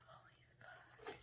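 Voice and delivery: very soft unvoiced whisper; awed whisper. Real, not acted. Reader stunned by the card, awed whisper. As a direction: whisper it in awe.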